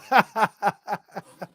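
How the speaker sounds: high rhythmic cackle of laughter; amused laugh. Real, not acted. Host reading a viewer's potato jab, amused laugh. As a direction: burst out in a run of ha-ha-ha. Reacting to potato personality comment.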